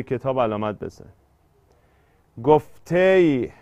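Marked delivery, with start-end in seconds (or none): 2.4-3.5 slow scansion. Shown as a demonstration. Scanning the first word of verse.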